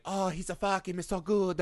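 soft, sultry mock Italian accent; Monica Bellucci. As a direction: moan it like an Italian seductress. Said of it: "like, inner Monica Bellucci".

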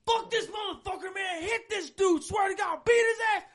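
high, loud shouted threats; retold angry yelling. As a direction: shout it like a raging street ranter. Retold crackhead yelling threats in street.

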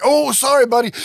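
high, loud sarcastic sorry; mock apology. Max mocking the winded kid, sarcastic high apology. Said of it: "And Mac's like".

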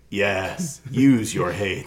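slow, gloating villain; sinister Emperor. Acted. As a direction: draw out the temptation. Voicing the Emperor, Star Wars.

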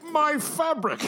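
high, gushing squeal of joy; delighted squeal. Acted. Sheriff delighted by package.

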